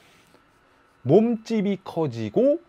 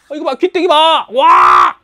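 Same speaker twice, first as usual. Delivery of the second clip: loud, high excited shout at the big ears; 13 semitones higher, 14 dB louder, slower than usual. Acted. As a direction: shout in amazement. Excited at huge ears picture. (신나서 크게 외치는 목소리)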